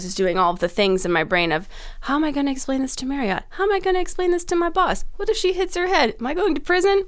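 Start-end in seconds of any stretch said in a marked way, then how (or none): 2.0-7.0 high-pitched worry, climbing after the first question; mock inner panic. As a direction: run through anxieties, rising higher. Inner worries escalate in a list.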